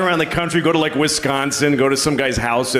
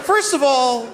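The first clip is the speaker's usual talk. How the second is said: high-pitched mock indignation; indignant; 12 semitones higher than usual. Comedian objects to the president's slur, indignant. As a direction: open a scolding, pitched way up.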